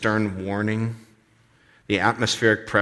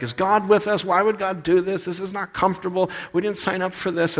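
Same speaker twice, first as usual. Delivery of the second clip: higher, lightly whiny mock complaint; a hint of a whiny crowd; whining Israelites; 9 semitones higher than usual. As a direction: mimic grumbling people, a bit high. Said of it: "huge whining and griping fest".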